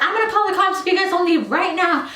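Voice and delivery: threatening teacher. Retold or imitated. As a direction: warn them off quickly. Other teacher threatens to call cops.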